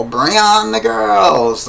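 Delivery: high, loud drawn-out rock-singer shout; imitated song yell. Reviewer quoting a lyric from the film's song, imitated yell. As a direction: stretch it out like a rock frontman's yell. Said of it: "you know, yell".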